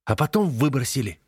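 furious. Acted. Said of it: "яростно добавляет".

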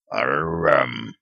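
a big wolf's low warning growl; low beastly growl. A narrator's reading. Wolf appears suddenly.

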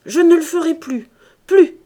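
high, loud insistent promise; crying out. A narrator's reading. Husband vowing to sick wife to stop, crying out. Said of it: "cria le tonnelier".

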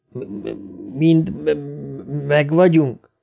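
soft, halting, hoarse question; hoarse stammer. A narrator's reading. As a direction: stutter on the m's in a hushed, husky voice. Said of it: "kérdezte Bill reket hangon". (rekedt, dadogós hang)

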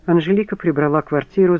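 plain reading voice

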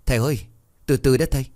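mildly shouted warning from a worried lad; alarmed shout. Acted. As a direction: call out urgently to stop him. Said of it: "Nó trợn mắt lên rồi hét".

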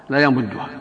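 ordinary talk, nothing marked

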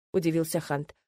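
plain reading voice

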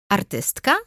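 mild inviting question; interested, rising query; encouraging. A narrator's reading. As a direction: gently prompt her to go on. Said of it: "rzuciłam zachęcająco".

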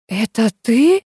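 dumbfounded rising question; mildly stunned query; foolish bewilderment. A narrator's reading. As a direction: ask it stupidly, in disbelief. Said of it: "глупо спросила я".

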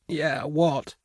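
high, hushed, frightened squeak; terrified squeak. A narrator's reading. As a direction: squeak it out, frozen with terror. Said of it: "Karl squeaked in frozen terror".

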